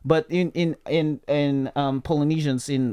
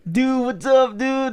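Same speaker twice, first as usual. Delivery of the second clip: higher, drawled surfer-dude greeting; mock Californian; 9 semitones higher, 3 dB louder, slower than usual. Friend teasing how a Californian should sound, mock dude voice. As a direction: put on an exaggerated California dude voice. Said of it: "How are you supposed to sound like?".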